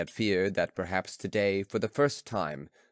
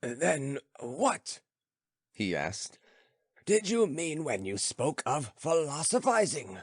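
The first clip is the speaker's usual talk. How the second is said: lightly voiced philosopher, higher and musing; old philosopher; 6 semitones higher, slower than usual. Philosopher musing to his friend, lightly voiced. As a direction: ponder aloud, slowing on stone still.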